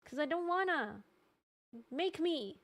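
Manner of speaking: high, soft bratty refusal; playfully defiant. Pretend. Streamer arguing with own body, mock defiant. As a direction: refuse like a petulant kid, half-joking. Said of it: "And I'll be like".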